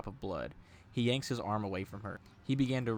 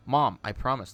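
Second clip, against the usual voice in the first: louder, insistent plea; pleading cry; 3 semitones higher, 8 dB louder than usual. A narrator's reading. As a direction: cry it out to convince your mom. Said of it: "Tom cried".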